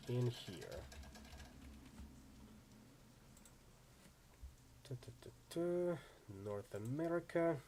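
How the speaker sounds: soft, halting talk to oneself; absent-minded aside. Real, not acted. Player looking up California Free State in a book, absent-minded. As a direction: think aloud quietly while hunting for a page. Searching the book mid-reading, to self.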